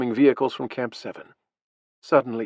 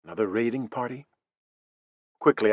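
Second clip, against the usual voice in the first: usual speaking voice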